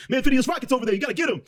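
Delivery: squeaky high sidekick; high Tony voice. Retold or imitated. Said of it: "And Tony goes".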